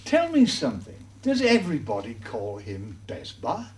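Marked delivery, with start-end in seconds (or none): none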